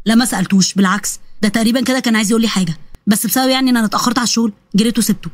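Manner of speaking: low male character voice; young man explaining. Acted. (شاب بيحكي بصوت واطي)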